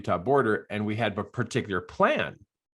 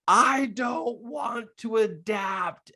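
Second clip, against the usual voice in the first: put-on high-pitched, drawn-out toddler whine; imitated child's tantrum cry, stretched out slowly; 12 semitones higher, much slower than usual. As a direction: wail it like a small kid mid-tantrum. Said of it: "then he threw a huge tantrum".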